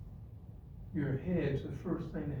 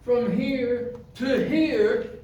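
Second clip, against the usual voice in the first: slow, weighty stretched key words at a raised pitch; forceful preaching; 9 semitones higher, 7 dB louder than usual. Real, not acted. Teaching the audience that self-discipline is hard, forceful preaching. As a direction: preach it hard, leaning on each phrase.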